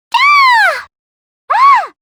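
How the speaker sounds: high, loud short scream; sharp frightened cry; startled scream. Acted. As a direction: yelp in sudden alarm. Character screams.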